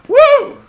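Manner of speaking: loud high whoop. Real, not acted. Vlogger reacting to a wrestler's looks with a loud high whoop. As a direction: let out a leering cheer.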